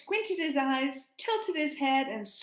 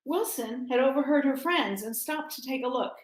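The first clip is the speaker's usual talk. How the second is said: ordinary talk, nothing marked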